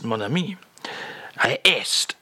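ordinary delivery

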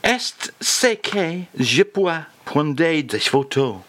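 very slow, deliberate French; slow French reading. Shown as a demonstration. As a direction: spell out the French phrase word by word. Host reads out the revealed French phrase.

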